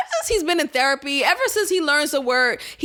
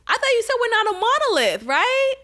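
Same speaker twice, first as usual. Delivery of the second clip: higher, faster taunting voice; mock retort; 10 semitones higher than usual. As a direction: throw their words back with a high sarcastic jab.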